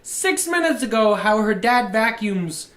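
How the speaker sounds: high, loud, insistent outburst; loud protest. Real, not acted. Friend calling out what was said six minutes ago, loud protest. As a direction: protest at the top of your voice. Mock outrage at friend's joke.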